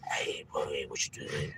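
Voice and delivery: hushed whisper between partners. Retold or imitated. Cop whispering to his partner about the scheme, hushed. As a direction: whisper it so nobody hears. Cops talking amongst themselves.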